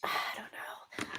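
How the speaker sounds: slow unsure whisper. Real, not acted. Medium unsure of a message: slow whisper. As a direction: whispers it to herself, slowly.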